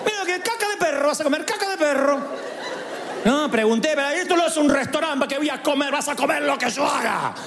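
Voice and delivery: high, scolding mother with laughs; mock scolding mother. Mother scolding her son who asks about dinner. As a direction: lay down the law like a fed-up mom. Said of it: "O la mía era".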